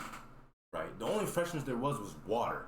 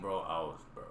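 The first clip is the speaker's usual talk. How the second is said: ordinary delivery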